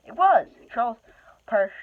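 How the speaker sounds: high, loud burst of narration; spring the twist on the listener; a sudden high, loud "It was!"; excited exclamation. A narrator's reading.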